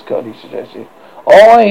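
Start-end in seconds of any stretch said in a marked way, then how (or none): 1.3-1.7 a loud hint of Slightly; lightly voiced Slightly. Boy agreeing with Curly, a loud lightly voiced aye. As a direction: bark a hearty aye. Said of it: "he said slightly".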